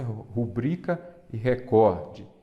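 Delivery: slow "Rubrica e recorde", stressing BRI and COR; pronúncia correta. Shown as a demonstration. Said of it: "As duas mais complicadas". ("Rubrica e recorde" devagar, com bri e cor fortes)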